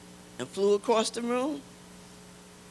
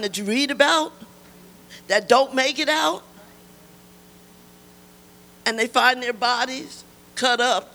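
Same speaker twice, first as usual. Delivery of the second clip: raised, loud preaching voice; impassioned testimony; 4 semitones higher, 6 dB louder than usual. Real, not acted. Survivor telling congregation she was almost murdered, impassioned testimony. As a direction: testify forcefully, pitched up and loud.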